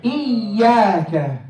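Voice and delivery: "Iyyaka" slowly, y pulled back then released; drawn-out shadda. Shown as a demonstration. Teacher repeating for the class, "Iyyaka" with a long held y. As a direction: slingshot the double y in "Iyyaka". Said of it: "Think of it like a slingshot".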